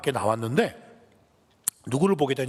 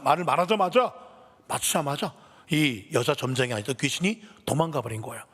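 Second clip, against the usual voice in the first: ordinary delivery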